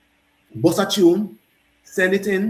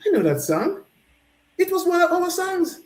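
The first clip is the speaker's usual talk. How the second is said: excited; 12 semitones higher than usual. Retold or imitated. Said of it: "and I said".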